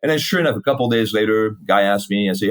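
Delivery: normal talking voice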